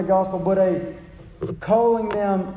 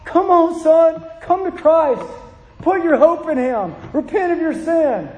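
higher, louder call to a son; pleading call; 6 semitones higher, 4 dB louder, slower than usual. Retold or imitated. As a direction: plead with your son to come. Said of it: "don't you want it to be".

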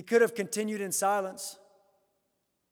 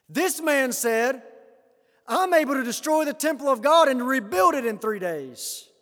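mock accuser; 5 semitones higher, 5 dB louder than usual. False witness quote, loud and slow.

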